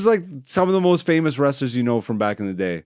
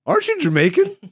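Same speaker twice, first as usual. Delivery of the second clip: 1 mock Triple H; 12 semitones higher than usual. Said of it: "Triple H is like".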